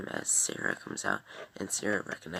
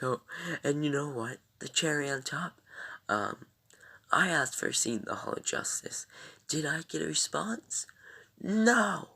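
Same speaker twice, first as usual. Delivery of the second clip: higher, louder rant building to a shrieked no; hammed-up outraged complaint peaking at the end; imitating Nate's rant; 8 semitones higher, 6 dB louder than usual. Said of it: "he's like".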